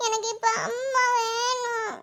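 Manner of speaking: put-on slow, softly tearful child's plea; tearful whining. Sick child wanting her mother now, tearful whine. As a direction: drag the words out in a weepy whine.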